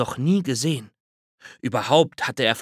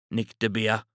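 ordinary delivery

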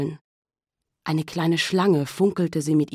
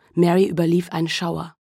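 usual speaking voice

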